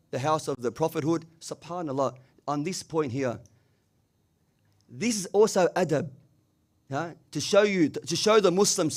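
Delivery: ordinary talk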